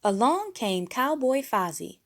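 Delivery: ordinary delivery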